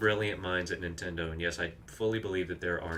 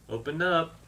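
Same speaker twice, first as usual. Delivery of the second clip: louder, higher shout at the enemy; calling out; 9 semitones higher, 6 dB louder than usual. Real, not acted. Gamer to a game enemy before throwing a bomb, calling out. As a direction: shout a sharp rising command.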